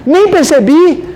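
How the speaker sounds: high, loud, slow fake astonishment; mock surprise. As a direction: gush with exaggerated surprise to the pregnant woman.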